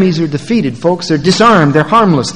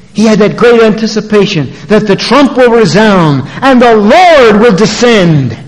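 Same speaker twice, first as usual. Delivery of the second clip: high, swelling pulpit delivery; triumphant preaching; 7 semitones higher, 6 dB louder than usual. Real, not acted. Preacher tells of hope in the Lord's descent, triumphant preaching.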